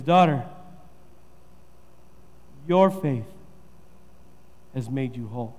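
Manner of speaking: soft, gentle, low reassurance; tender Jesus. Shown as a demonstration. Jesus tenderly reassures the woman, soft and low. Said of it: "He looks at her and he says".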